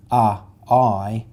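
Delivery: teacher sounding out vowels; isolated vowel sounds. Shown as a demonstration. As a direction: sound out uh and I distinctly. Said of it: "the vowel sounds are uh and I".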